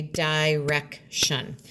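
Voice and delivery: "direction" said slowly, beat by beat; "direction" in clapped syllables. Shown as a demonstration. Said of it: "if I was clapping this".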